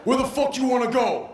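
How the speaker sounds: gruff cabbie snapping at the fare; rude cabbie. Retold or imitated. Cab driver barking at the soaked tourist. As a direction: play the stoned rude driver.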